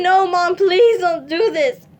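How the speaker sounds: high, loud, slow sobbing plea; tearful pleading. Retold or imitated. Begging her mom to put the knife down, sobbing. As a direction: beg through tears. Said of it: "I screamed".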